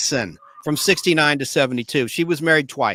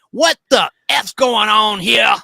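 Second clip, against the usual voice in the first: slow, loud Southern prison-guard yell; Southern accent; 3 semitones higher, 4 dB louder, slower than usual. Retold or imitated. Host quoting a sadistic guard in a Southern accent. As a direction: bark it slow with a Southern drawl. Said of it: "she had a Southern accent".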